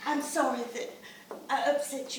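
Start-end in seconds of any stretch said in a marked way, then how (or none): none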